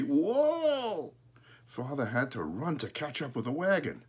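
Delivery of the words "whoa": high, drawn-out crow mimicry; long squawky high whoa; talking crow. A narrator's reading. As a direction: mimic the halt call in a high bird voice. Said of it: "Then Father heard Smokey say".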